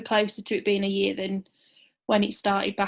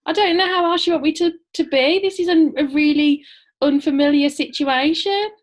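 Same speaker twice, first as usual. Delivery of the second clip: higher, louder defensive retort; indignant protest; 6 semitones higher, 7 dB louder than usual. Real, not acted. Wife defending herself to husband who criticised her, indignant protest. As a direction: protest loudly that there is nothing odd going on.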